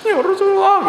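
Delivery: high, loud crying cry of Ya Rasulullah; a poor man weeping to the Prophet; tearful plea. Retold or imitated. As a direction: plead through tears to the Prophet.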